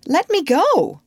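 high, loud command; ordering. A narrator's reading. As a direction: demand to be released. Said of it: "she ordered him".

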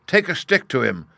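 high, loud command; shouted order. A narrator's reading. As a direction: call out the order forcefully. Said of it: "shouted the Vice Warden".

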